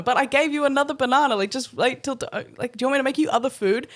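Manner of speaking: high defensive pleading. Retold or imitated. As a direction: plead your case, rushing. Pleading excuse to angry friend.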